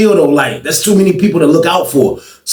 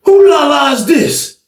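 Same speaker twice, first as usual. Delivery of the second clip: high, slow drawn-out question; mock voice; 9 semitones higher, slower than usual. Small guy asking whose weed it is, high and slow mocking.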